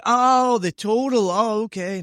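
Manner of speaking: high, loud mock "ohh" of understanding; hammed-up penny-drop reaction; sudden realisation. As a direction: imitate students finally catching on. Students suddenly understanding the word.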